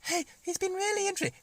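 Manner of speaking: high, fast squeaky puppet chatter; a quick little puppet squeak; high cartoon dog voice. Puppet Grippy chats.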